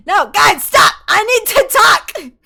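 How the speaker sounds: high, very loud pleading yell; exasperated shout. Real, not acted. Streamer begging distracting viewers to let her talk, exasperated shout. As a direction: yell over them, dragging the words out into a wail.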